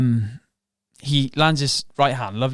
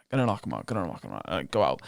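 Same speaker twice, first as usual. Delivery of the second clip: much lower, much softer, drained of all energy; exhausted mumble; 8 semitones lower, 8 dB quieter, faster than usual. Retold or imitated. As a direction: mumble it back with nothing left. Exhausted fighter answering his coach.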